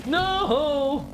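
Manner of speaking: high pained shriek; frustrated scream. Real, not acted. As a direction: cry out in frustration. Hit in game, frustration.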